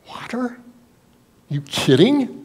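high, soft squeak of disbelief on water; can't believe the request; incredulous servant. Acted. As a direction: servant balking at filling jars with water, disbelieving. Servants doubting Jesus' order.

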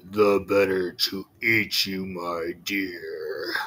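low wolf, sinking to a whisper; a hungry wolf closing in, ending in a whisper; menacing wolf. Acted. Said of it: "cried the wolf".

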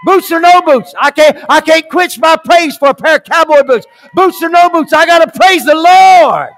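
high, loud ecstatic cry over a choir; yelling. Acted. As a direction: burst out, unable to hold back praise. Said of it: "he yelled, the choir singing loud".

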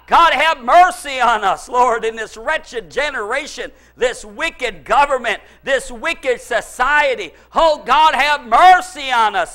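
loud, slow pleading cry; cry out for mercy. Shown as a demonstration. The church cries out to God, loud and pleading. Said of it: "The church needs to begin to cry out".

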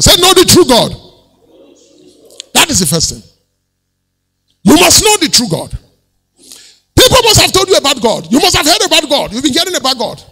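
loud, high-pitched pulpit proclamation; forceful preaching. Real, not acted. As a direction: drive it home to the whole congregation at full voice.

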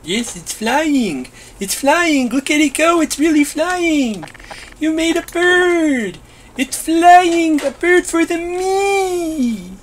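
high, rising joyful cries; giddy delight. Acted. Bee voice thrilled as the bird takes flight. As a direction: squeal with pride over the bird.

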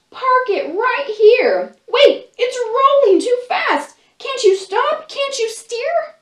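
high, loud panicky shouting; monkey shouts. Acted. The monkey yelling as the car rolls downhill, loud panicky shout. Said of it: "one little monkey shouts".

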